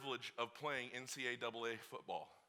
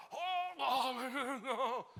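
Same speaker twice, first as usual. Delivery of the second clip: laughing groan; 9 semitones higher, much slower than usual. Retold or imitated. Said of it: "we are like".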